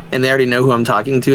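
usual speaking voice

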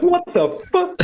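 sudden high squeal of disbelief, laughing; shocked disbelief. Retold or imitated. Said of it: "You'd be like".

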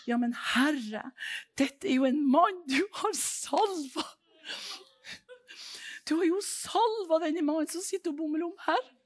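a humble man begging the Lord; David pleading with God. Acted.

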